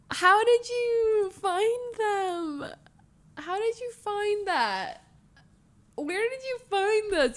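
high, drawn-out marvelling; slow, high-pitched amazed wail; touched disbelief. Real, not acted. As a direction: stretch the question out in wonder. Moved, marvelling at surprise clips.

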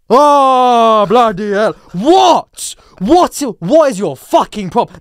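loud, high-pitched indignant shout; acted fed-up yelling; exasperated outburst. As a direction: explode at someone in disbelief.

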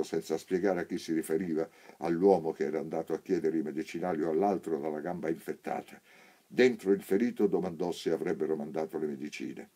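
plain reading voice

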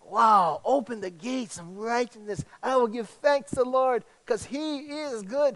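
bright, cheery reading of the psalm; upbeat-sounding. Pretend. Preacher to church, making the psalm sound cheerful. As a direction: make it sound so positive. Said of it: "although it sounds".

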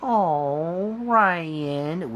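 ordinary delivery

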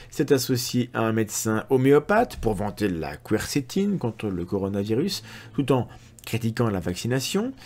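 ordinary talk, nothing marked

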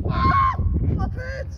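high startled yelp, slow; surprised yelp. Real, not acted. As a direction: yelp as it soaks through. Soda spills on pants.

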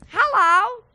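high, loud goofy puppet voice; squeaky puppet hello. Acted. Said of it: "What, what was that voice?".

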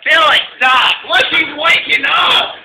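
shouting. Real, not acted. Yelling at friend to stop.

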